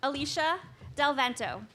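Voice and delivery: projecting names across the hall; firm carrying classroom voice; teacher voice. Real, not acted. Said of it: "I have to use my teacher voice".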